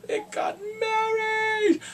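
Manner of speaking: high, stretched-out cry; ecstatic shout. Real, not acted. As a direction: squeal it slowly with overjoyed excitement. Ecstatic cry over the wedding.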